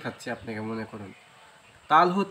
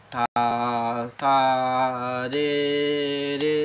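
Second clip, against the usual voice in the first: slow tempo; 5 semitones lower, slower than usual. Shown as a demonstration. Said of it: "এটা কিন্তু ঝিমালয় করছি".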